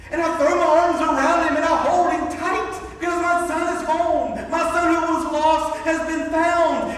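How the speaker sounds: loud, high, jubilant telling; overjoyed. Retold or imitated. Father hugging his returned son, loud and overjoyed. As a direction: pour out the joy of the son come home.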